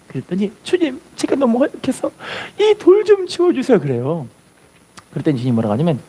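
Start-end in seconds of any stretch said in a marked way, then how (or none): 0.6-3.8 put-on pleading boy. Boy begs Jesus.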